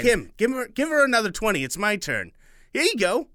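put-on high eager child; excited boy; kid voice. Kids voiced.

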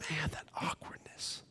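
hushed whisper of awkwardness. Acted. Pastor dwelling on Peter's awkward meeting with Jesus, whispered. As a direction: breathe it out, barely voiced.

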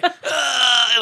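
hammed-up groan for the camera; comic pained groan. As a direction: mug a pained ugh. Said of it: "he hits it and he's like".

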